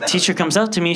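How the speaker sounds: ordinary talk, nothing marked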